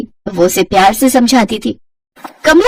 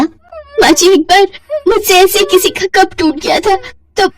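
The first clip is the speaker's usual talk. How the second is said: shaken bride; 9 semitones higher than usual. Acted. Simran tells her mother-in-law about the burning, high shaken voice. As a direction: recount the burn still frightened.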